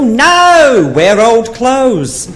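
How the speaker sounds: high, slow knowing advice; deadpan friend's advice. Retold or imitated. Rebecca advising her friend, high and slow. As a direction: drop the punchline slow. Punchline, old lady.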